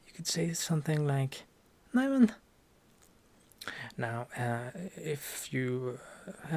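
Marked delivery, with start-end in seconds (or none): 1.9-2.3 "Neimen" high and loud, bursting with joy; joyful "Neimen" on seeing family; happy surprise. Shown as a demonstration. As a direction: greet them with a delighted cry. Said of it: "And you're happy to see them".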